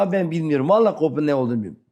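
ordinary talk

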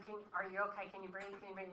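gentle worried questions; worried whisper-soft. Retold or imitated. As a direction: sister fretting over her breathing. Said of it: "she's like".

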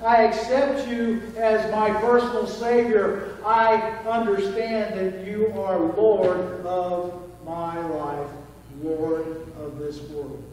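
earnest, slowing prayer voice; model prayer. Shown as a demonstration. Preacher models a simple prayer for the congregation, sincere and slow. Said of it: "just as simple as".

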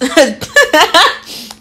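real, sheepish burst of giggles; laughing at herself. Real, not acted. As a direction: laugh at your own odd recipe. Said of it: "'Cause I'm laughing at myself".

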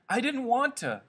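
high, loud wail; distressed cry. A narrator's reading. Whimpering Lenny excuses himself after the fight, crying out. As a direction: cry it out, pitch way up. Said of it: "Lenny cried".

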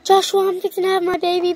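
ordinary talk

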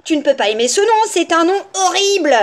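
put-on loud, exasperated child; outraged little girl; indignant protest. A narrator's reading. Girl protests the feigned delight.

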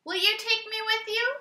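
high, eager little dog voice; dog asking. A narrator's reading. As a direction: squeak it out like an eager puppy. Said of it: "said the dog".

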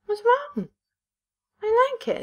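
squeaky high offended whine; high falsetto offended voice. Pretend. Offended about outfit.

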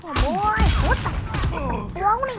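high, loud yelp of surprise; startled outburst. Real, not acted. Surprise during game fight.

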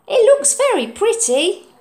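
high, loud admiring; pleased boy. Acted. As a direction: admire the bowl. Oliver's voice.

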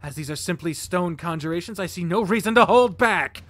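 rising to high, loud vow; righteous paladin. Acted. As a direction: build into a holy battle cry. Artemy paladin voice.

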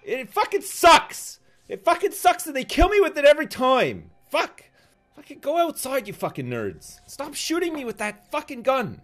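loud furious rant at nerds; raging gamer. Real, not acted. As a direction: yell it out, furious and high. Raging at other players.

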